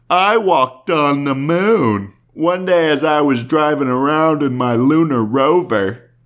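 slow, dopey comedian voice with loud rising lines; Brian Regan idiot voice. Pretend. Regan on request does his goofy voice, slow and loud. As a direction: drag it out like a proud dimwit. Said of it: "would you be able to do a little of the voice for us?".

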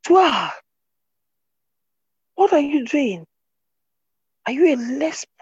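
high, loud mock scream of the church woman; retold scream. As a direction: play the woman shrieking across the church. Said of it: "was screaming".

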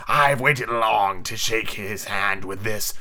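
slow pirate captain menace; drawn-out villain's threat; threatening. Acted. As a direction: threaten slowly, swelling on the ooh.

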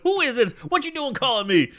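pitched high, alarmed; startled Holla. Retold or imitated. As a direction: yelp it like a spooked comic. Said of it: "And Holla's like".

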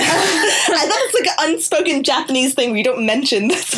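high voice bubbling with laughter; laughing while talking. Real, not acted. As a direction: push the words out through giggles. Laughing about her awkward dinner.

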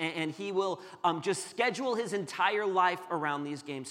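ordinary talk, nothing marked

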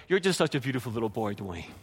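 put-on low, soft, quick cooing to a little boy; imitating doting mom. Mom praising her little boy, imitated doting coo. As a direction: coo it like pet talk, dropping very low on the name. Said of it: "talks to me the way I talk to my dog".

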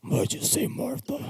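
high whispered mock Batman, slow. Friend does a whispered Batman line. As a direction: squeak it out in a breathy whisper.

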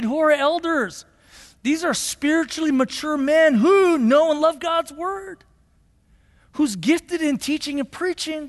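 high-pitched pulpit teaching with force; impassioned preaching. Real, not acted. Preaching with raised voice.

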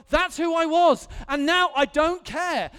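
loud, high preacher's declaration; emphatic shout. Real, not acted. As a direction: belt it out to the congregation. Preacher's rising emphatic confession.

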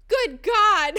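loud, high burst of horror; explosive mock-horrified outburst; shocked exclamation. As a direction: imitate a doctor blurting in shock. Chiropractor shocked on opening the scan.

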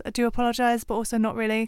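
usual speaking voice

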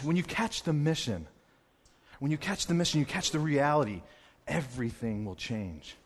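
soft, sincere preaching; earnest, hushed. Real, not acted. Preacher on catching the mission, soft and sincere. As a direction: drop the volume and mean every word. Turns from comedy to earnest message.

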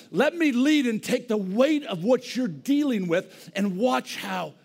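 loud, high, impassioned preaching; fiery sermon voice; preaching at full force. Real, not acted.

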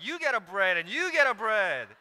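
loud, high showy TV-host shout; hammed-up Oprah giveaway. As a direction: announce it like a giddy game-show prize. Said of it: "pass it out like Oprah".

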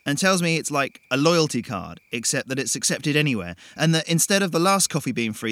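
put-on chatty young man, a bit full of himself; Jason, breezy storyteller. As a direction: tell it like a cocky guy spinning a yarn. Jason voiced, carefree retelling.

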